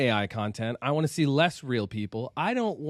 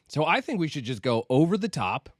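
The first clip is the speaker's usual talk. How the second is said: usual speaking voice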